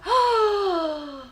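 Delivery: loud high gasp; amazed gasp. Real, not acted. Amazed at accuracy.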